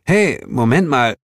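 taken-aback protest; aghast. A narrator's reading. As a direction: cut in, baffled. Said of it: "entgeistert fragte".

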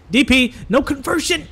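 high-pitched, building dismayed call; excited dismay. Real, not acted. Commentator calling a missed conversion, high excited dismay.

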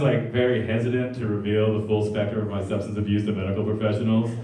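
usual speaking voice

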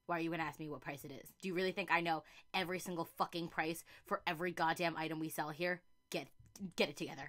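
low-volume scolding of the customer; muttered complaint to customer. Retold or imitated. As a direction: grumble at the customer so they barely hear. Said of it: "When customers ask you".

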